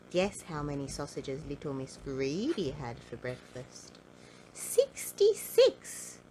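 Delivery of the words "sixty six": high, loud, slow astonished cry; slow high gasp of amazement; amazed exclamation. A narrator's reading. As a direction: announce it like a marvel. Narrator exclaims amazing number.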